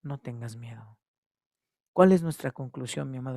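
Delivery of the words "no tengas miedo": soft reassurance. Acted. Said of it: "escuchar a Jesucristo decirnos".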